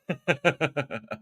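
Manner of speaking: light laugh to self; a short, light run of laughter. Real, not acted. Speaker laughing after apologizing in Spanish, light laughter.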